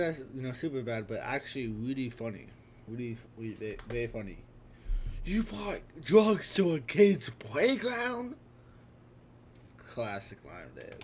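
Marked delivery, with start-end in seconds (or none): 5.2-8.4 loud, high, drawn-out incredulous shout; outraged movie quote. Acted. Quoting a classic line from a comedy, shouted in outrage. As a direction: shout it slowly in high-pitched disbelief.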